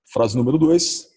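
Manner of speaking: normal talking voice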